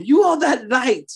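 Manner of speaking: high, drawn-out preacher's cry; rally the congregation, pitch lifted and words stretched; rousing exhortation. Real, not acted. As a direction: ring it out high and slow. Rallying congregation after "Come on, somebody.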